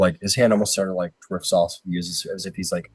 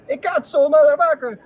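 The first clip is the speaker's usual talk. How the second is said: high, loud gnome cursing; comic squeaky Italian outburst; yelling Italian gnome; 8 dB louder than usual. Acted. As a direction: swear like a furious little Italian gnome. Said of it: "yelling at each other".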